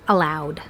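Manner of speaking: "allowed" ending in voiced d. Shown as a demonstration. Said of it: "pronounced with D".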